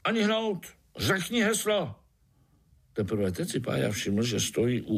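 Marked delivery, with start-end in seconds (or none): 0.0-2.0 high-pitched sentry challenge; sharp command. A narrator's reading. Sentry ant stops the little spider, sharp command. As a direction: halt the intruder with sharp, high orders. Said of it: "ostrý povel".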